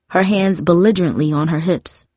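plain reading voice

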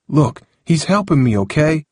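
mildly exasperated confession; reluctant admission. A narrator's reading. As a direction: admit it, asking for acceptance. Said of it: "Harold admitted".

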